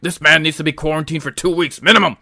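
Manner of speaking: fast, alarmed doctor; urgent doctor voice. Pretend. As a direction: rush the quarantine order. Mock doctor dialogue, other figure.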